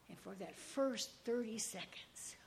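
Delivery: breathy whisper, slowed; hushed whisper of relief. Retold or imitated. Intimate confession.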